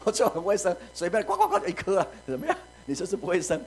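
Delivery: 笑着嘲讽; mock her through a laugh, hen clucking; laughing taunt. Preacher voices Peninnah mocking, laughing. (边笑边嘲弄)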